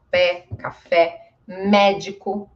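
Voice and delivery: "pé, café, médico" each with open eh. Shown as a demonstration. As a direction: stress the open E: PEH, ka-FEH, MEH-dee-koo. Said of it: "também tem este som".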